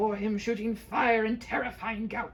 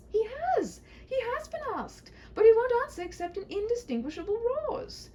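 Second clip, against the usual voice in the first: high, bright-voiced young princess pleading her case; a fretful princess, high and urgent; 5 dB louder than usual. Princess tells the knight about her father, high and urgent.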